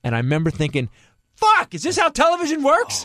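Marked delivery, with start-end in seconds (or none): 1.3-3.0 high, loud, exasperated yell; comic rant at full pitch; outraged outburst. Retold or imitated. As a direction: shout it in furious disbelief. Acts out his outraged reaction.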